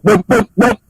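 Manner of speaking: three high, spaced vocal booms; imitated boom salute. Shown as a demonstration.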